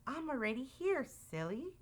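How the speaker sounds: small, soft Mouse voice; a mild squeak, kept soft. A narrator's reading. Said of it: "Mouse squeaked".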